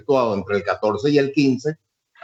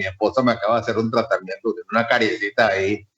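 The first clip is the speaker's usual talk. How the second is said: ordinary talk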